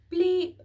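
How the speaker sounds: loud high single bleep; a sharp keypad beep; loud high mock keypad beep; beep noise. Keypad beep red light.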